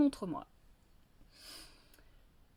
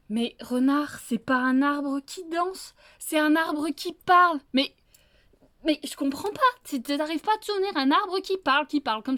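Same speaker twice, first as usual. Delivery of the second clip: higher, louder scolding that speeds up; wolf correcting the fox a second time; exasperated wolf; 5 semitones higher, 6 dB louder than usual. Acted. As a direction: hammer it into his head, voice climbing.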